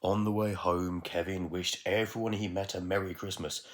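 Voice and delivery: plain reading voice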